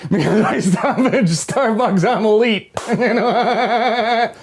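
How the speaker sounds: high loud laughing snob; mock elite boast. Boasting to viewers about Starbucks, mock snobbish. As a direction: ham up being elite.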